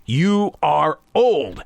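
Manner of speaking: put-on slow, with "old" stretched out and falling; imitating a blunt neighbor, "old" drawn long; emphatic admonition. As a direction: spell it out slowly, landing hard on "old".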